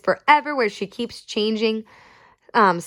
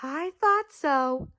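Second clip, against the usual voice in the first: knowing; 5 semitones higher, 4 dB quieter, slower than usual. A narrator's reading. Old woman knowing reply.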